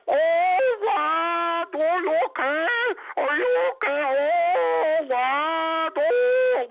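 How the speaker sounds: high, fussing voice in a mock Hungarian accent. Mocking Hungarian parents fussing over their son in a Hungarian accent. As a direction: coo like a worried Hungarian parent. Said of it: "talk in their stupid little Hungarian little accent".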